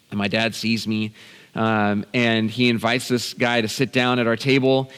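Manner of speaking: normal talking voice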